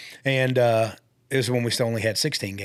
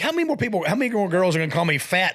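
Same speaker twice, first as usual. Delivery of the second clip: indignant; 7 semitones higher than usual. Retold or imitated. Indignant retold reply to caller.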